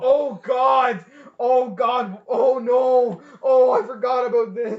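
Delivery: high, full-voiced shouts of dread; loud dismay. Real, not acted. Podcaster remembering the line about to be quoted, shouted dismay. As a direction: cry out as the memory hits.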